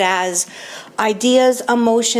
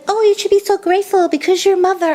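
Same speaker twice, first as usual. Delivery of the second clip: high, louder mock lecturing voice; sing-song mock sponsor, pitched way up; imitating the sponsor; 10 semitones higher, 3 dB louder, faster than usual. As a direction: put on a preachy high voice to mock her. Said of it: "imitate her this way".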